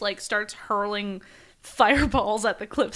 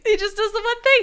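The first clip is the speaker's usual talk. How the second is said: mock incredulous; 13 semitones higher, 5 dB louder, faster than usual. Mocking repeat of friend's question.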